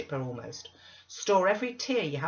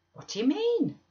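high, loud puzzled question; taken aback; 7 dB louder than usual. Acted. Zillah questions Missus Dean's accusation, taken aback. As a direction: ask it in surprise, pitched right up.

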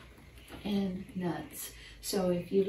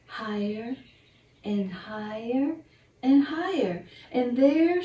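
rising higher and louder with each higher; building excitement; 4 semitones higher, 8 dB louder than usual. Acted. The squirrel climbs to the treetop, building excitement. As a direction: build it up step by step to the top.

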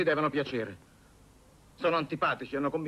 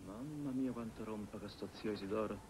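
muttered much softer under his breath; grumbling aside; 3 semitones lower, 13 dB quieter than usual. Acted. Nephew grumbling to himself about uncle Isidoro, muttered softly. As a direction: grumble it so the aunt can't hear.

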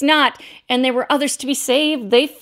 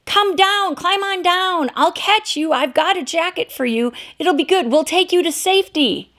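calling up high from a boat, higher pitch; yelling up; 8 semitones higher than usual. Retold or imitated. Rescuer calls up from the boat, yelling. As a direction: shout up to the man at the window. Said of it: "he yelled up to him".

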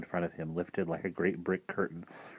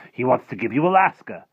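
higher, louder grand reveal; excited offer; 6 semitones higher, 10 dB louder than usual. Acted. Rictus offering Harvey a whole state, excited. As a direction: top the last offer, lifted and big.

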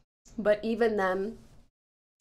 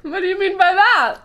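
loud, high indignant demand; mock outrage; 8 semitones higher, 12 dB louder than usual. Real, not acted. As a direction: act hammed-up offended. Mock offense at equity refusal.

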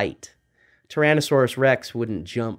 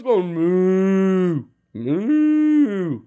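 higher pitched mock moo; cow mooing; 6 semitones higher, much slower than usual. Cow lowered into raptor cage.

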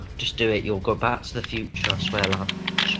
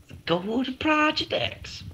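put-on intro voice; 14 semitones higher, slower than usual.

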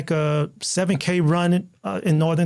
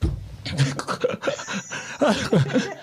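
a quiet, airy burst of real laughter; breathy laugh; 4 dB quieter than usual. Real, not acted. Laughing at the biker's prison story.

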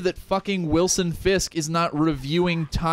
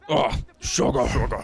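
cockroach voice; 5 semitones lower than usual. Acted. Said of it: "do your cockroach guy impression".

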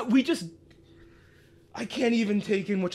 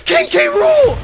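much higher, loud screaming joy; ecstatic shout; 10 semitones higher, 8 dB louder than usual. Real, not acted. Fan screaming at the reveal of a character he wanted. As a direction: scream the name as if the wish just came true.

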